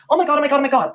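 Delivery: imitating Colleen's put-on gasping surprise; fake shock. Imitating Colleen faking shock at the Josh news, high and mock-gasping. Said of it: "Colleen, pretending she has no idea what's going on".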